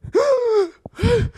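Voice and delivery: high, drawn-out starstruck gasp; acted-out astonished gasping; shocked gasp. Reacts to overhearing "Hey, Dad.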